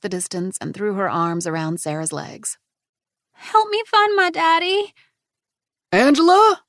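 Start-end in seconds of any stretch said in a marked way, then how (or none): 3.3-4.9 put-on high, slowish child's plea; pleading little girl. A narrator's reading. 5.9-6.6 loud, high frantic yell of a name. A narrator's reading. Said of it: "a deep voice yelled".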